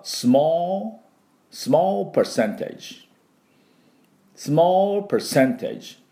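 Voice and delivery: teacher model phrase; slow model English. Shown as a demonstration. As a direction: draw out small percentage for students. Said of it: "今度はわずかな割合".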